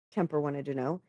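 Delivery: plain reading voice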